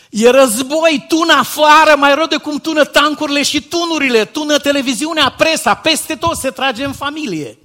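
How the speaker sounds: loud, high, urgent sermon climax; preacher raising his voice to alarm the congregation; fiery preaching. Acted. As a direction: thunder it out like a war cry from the pulpit. Preaching at full force.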